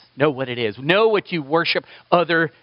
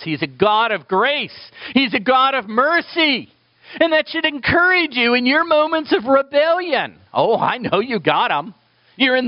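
fervent voice climbing higher and higher; impassioned preaching; 9 semitones higher than usual. Real, not acted. Preacher encouraging sinners with God's mercy, impassioned. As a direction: build to a ringing exhortation.

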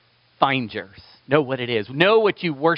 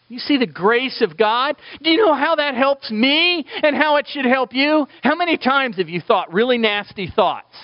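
high, insistent preacher's questions; impassioned preaching; 9 semitones higher than usual. Real, not acted. Preacher challenging the congregation about God's grace, impassioned. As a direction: press the congregation with fervent questions.

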